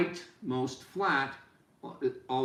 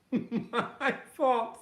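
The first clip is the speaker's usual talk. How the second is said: laughs it off, high and a bit louder; laughing; 10 semitones higher, 3 dB louder than usual. Real, not acted. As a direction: own the blunder with a real laugh. Lights failed, laughs at himself.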